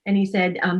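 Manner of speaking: normal talking voice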